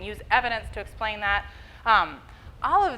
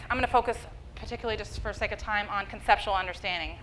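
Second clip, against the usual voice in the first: usual speaking voice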